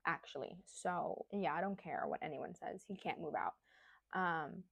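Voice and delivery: ordinary talk, nothing marked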